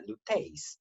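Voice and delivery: "luteis" with the final i wrongly stressed; wrong diphthong stress. Shown as a demonstration. Teacher showing how not to say it, "luteis" stressed on the i. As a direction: push the stress onto the i in "luteis". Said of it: "se pronuncia como si estuviera sobre la primera".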